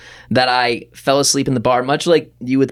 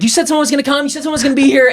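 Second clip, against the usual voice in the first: retold panicked plea; 11 semitones higher, much faster than usual. Retold panicked call to 911.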